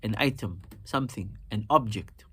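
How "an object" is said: "an object" with OB stressed, falling after; stress on first syllable. Shown as a demonstration. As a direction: lean on OB in "an object", as the noun. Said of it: "We naturally emphasize the first syllable".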